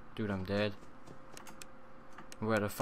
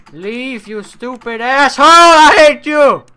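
much higher and louder, screaming abuse; furious yelling; 10 semitones higher, 19 dB louder than usual. Real, not acted. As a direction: scream him out of the closet. Yelling at Freddy in closet.

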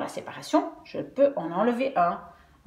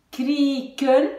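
KRIEKEN coupé en «krie-ken», le I allongé; séparer KRIEKEN en deux morceaux tenus; syllabes séparées; 3 semitones higher, 3 dB louder than usual. Shown as a demonstration. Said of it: "Même si je sépare ici". (KRIEKEN découpé, voyelle longue)

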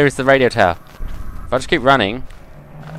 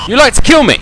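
high, loud mock accusation; mock outburst; 13 semitones higher, 9 dB louder, faster than usual. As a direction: hurl it like a dramatic lover. Mock breakup with Slender.

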